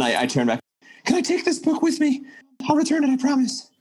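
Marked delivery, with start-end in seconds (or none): 1.0-3.7 very high falsetto voice; high-pitched, pleading falsetto; squeaky eager character. Acted. Player's character asks librarian for the book, in squeaky falsetto.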